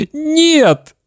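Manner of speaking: very high wailed refusal; bawling boy. Acted. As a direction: wail it like a crying child. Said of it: "ревел Славик".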